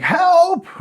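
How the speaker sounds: high, loud mock yelp; cry for help. Flight attendant calling to passengers for backup, high yelled plea. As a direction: imitate a panicked call for help.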